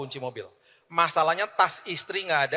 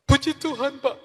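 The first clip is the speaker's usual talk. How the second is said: tearful high voice, much higher; crying driver; 10 semitones higher, 6 dB louder than usual. Retold or imitated. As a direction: play the weeping driver pleading. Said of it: "Dia nangis". (sopir menangis terharu, suara tinggi)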